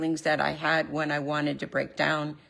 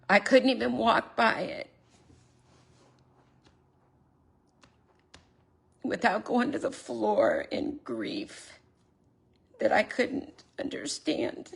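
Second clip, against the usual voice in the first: near tears; 4 semitones higher than usual. Real, not acted. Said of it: "I'm gonna try not to cry".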